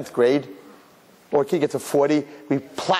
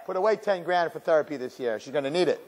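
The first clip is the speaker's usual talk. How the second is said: normal talking voice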